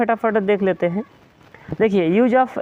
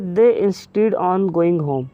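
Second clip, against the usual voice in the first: slow example reading; much slower than usual. Shown as a demonstration. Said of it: "अब देखिए".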